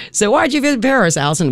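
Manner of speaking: high, fast playful voice of someone asking; mock questioner. DJ voices an imagined friend asking about Paris, high and quick. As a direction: put on a chirpy high voice for the imagined asker.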